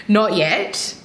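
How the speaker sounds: real laugh through the words. Real, not acted. To her viewers, laughing at her old reluctance. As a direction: chuckle out the last two words.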